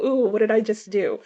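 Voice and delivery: ordinary talk, nothing marked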